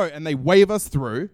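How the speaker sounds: usual speaking voice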